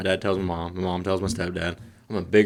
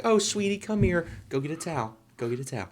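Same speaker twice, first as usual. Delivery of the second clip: sweet grandma; 7 semitones higher than usual. Retold or imitated. Mom fussing over the spilled milk, high sweet coo. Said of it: "and she's like".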